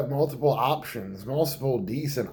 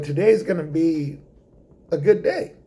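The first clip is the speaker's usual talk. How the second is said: upbeat; 6 semitones higher, 4 dB louder than usual. Acted. Upbeat morning self-talk.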